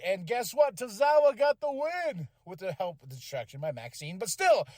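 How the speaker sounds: ordinary talk, nothing marked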